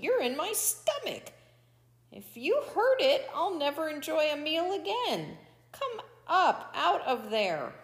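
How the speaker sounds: high, agitated coyote voice; alarmed pleading. A narrator's reading. Coyote alarmed, begging Toad out.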